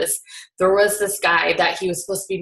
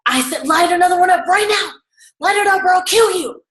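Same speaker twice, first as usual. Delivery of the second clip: much higher and louder, a furious scream; screaming threat; 11 semitones higher, 6 dB louder than usual. Retold or imitated. Tony screams at the sobbing smoker, furious threat. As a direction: scream the threat in his face. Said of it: "he's screaming and he's yelling".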